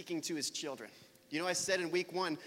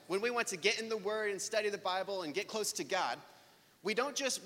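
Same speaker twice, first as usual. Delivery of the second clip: normal talking voice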